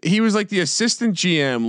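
ordinary talk, nothing marked

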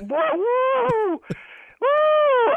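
very high-pitched whoops; relieved whooping. Real, not acted. Victim lets go after learning of prank, whooping. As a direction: whoop the tension out.

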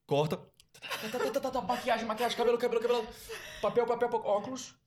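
high, hurried crew orders; produção apressada. Retold or imitated. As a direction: bark quick orders like a frantic floor manager. Mimicking TV crew rush. (equipa de TV apressada, mais aguda)

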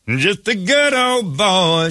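good old boy in a hammed-up Southern accent; hillbilly. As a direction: draw it out like a country hillbilly. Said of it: "I've got a hillbilly about to cut me".

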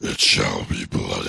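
very low, slow menacing voice; villain voice. Pretend. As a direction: growl it like a dark lord. Villain-like proclamation.

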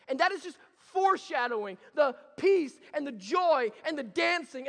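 loud, high, joyful sermon build; full-force preaching. Acted. Pastor stirring the congregation, loud joyful preaching. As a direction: preach it with rising joy.

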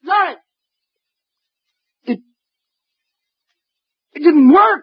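high, breathless terror; terrified. Acted. Detective at the end of the countdown, terrified then stunned. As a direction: brace for death, then gasp in relief. Awaits death, then survives.

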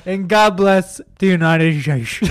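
slow "And God bless the United States" with slurred sh; mock Trump sign-off; imitating Trump's slurred "United States"; Trump imitation. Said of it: "He said".

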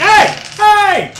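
loud high call; shouting after someone. Acted. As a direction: yell down the street. Shopkeeper calls after thief.

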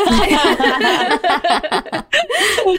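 bursts out in a high laugh; laughing loudly at her own joke. Real, not acted.

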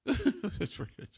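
soft, slow laughing remark; amused chuckle running into quiet words; chuckling. Shown as a demonstration. Host shows an acceptable laugh, softly chuckling. Demonstrates a slightly bigger normal laugh.

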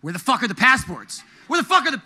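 retold panicked swearing, cut off high; rising panic. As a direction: act out escalating alarm. Retold rising panic over missing passports.

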